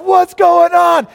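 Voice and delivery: high, slow, loud shout of confusion; baffled shout. Retold or imitated. Big players baffled by foaming kids, high loud shout. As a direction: yell it in total bewilderment.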